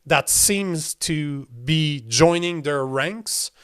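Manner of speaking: ordinary delivery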